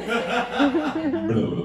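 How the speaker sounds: high squeaky gut gurgle; gurgling stomach noise. Retold or imitated. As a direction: make the noise of a rumbling belly. Belly noise imitated.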